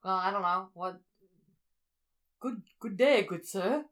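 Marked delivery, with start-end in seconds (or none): 2.4-3.8 a lightly put-on English gentleman greeting; half-hearted British impression; mock British accent. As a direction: try a posh English accent and miss. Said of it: "that doesn't sound right either".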